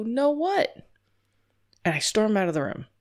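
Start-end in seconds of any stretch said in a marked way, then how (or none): 0.0-0.9 much higher and puzzled; confused guest questioning the host; baffled question. Pretend. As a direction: ask in total confusion.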